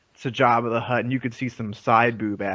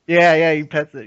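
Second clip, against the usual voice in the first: ordinary talk, nothing marked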